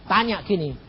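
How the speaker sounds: ordinary delivery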